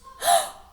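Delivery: loud high shocked gasp. Acted. Teacher hinting the twist to kids, big gasp.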